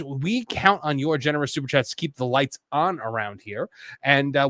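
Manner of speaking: ordinary delivery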